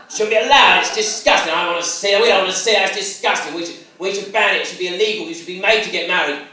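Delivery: hammed-up lads yelling abuse from a car; yobs shouting. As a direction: lads jeering from the car, mock loud rant. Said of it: "they started shouting".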